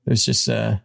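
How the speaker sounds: usual speaking voice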